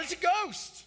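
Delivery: high frightened cry of It's a ghost; cried out. A narrator's reading. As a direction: disciples crying out in fear. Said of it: "they cried out".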